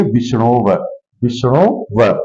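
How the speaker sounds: teacher's quiet model of विष्णु व; model pronunciation. Shown as a demonstration. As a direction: carefully voice विष्णु व for the class. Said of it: "इसको उच्चारित क्या करेंगे?".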